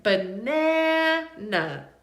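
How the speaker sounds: slow "Banana" with a long middle short A; stretched middle A. Shown as a demonstration. Teacher highlighting the middle A, drawn-out "Banana". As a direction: hold the middle vowel of "Banana", as in dad. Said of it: "the middle A sounds like".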